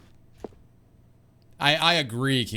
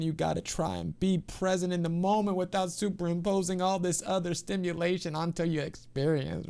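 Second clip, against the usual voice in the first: ordinary talk, nothing marked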